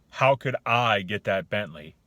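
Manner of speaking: loud, slow, scornful question; disbelieving tone. Shown as a demonstration. Coach demonstrating the closed attitude, disbelieving. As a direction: say it as if there's no way. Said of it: "The tone, the attitude".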